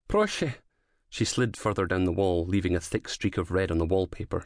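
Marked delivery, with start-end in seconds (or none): 0.1-0.5 pleading. Acted. Wounded woman pleading.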